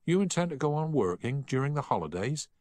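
can't believe his friend means it; in disbelief. A narrator's reading. Boy asks his studious friend, disbelieving. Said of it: "asked Harry in disbelief".

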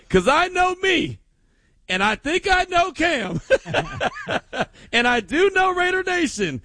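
laughing. Real, not acted. Host laughing about party chaos.

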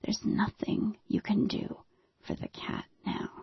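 husband urging his wife in a whisper. Retold or imitated. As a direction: whisper it, firm and scared. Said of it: "and he says".

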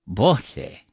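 Bote as two syllables, BAW-theh; Irish pronunciation. Shown as a demonstration. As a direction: repeat Bote as the Irish word, ending on an open eh. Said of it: "That's Irish".

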